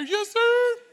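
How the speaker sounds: small daughter answering her father's call; child calling back. Retold or imitated. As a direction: call out like an eager kid answering dad. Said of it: "and then you hear".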